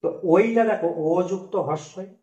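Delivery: ordinary talk, nothing marked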